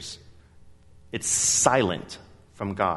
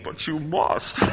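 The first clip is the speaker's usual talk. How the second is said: Bane voice; 4 semitones higher, slower than usual. Retold or imitated. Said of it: "I can hear Bane".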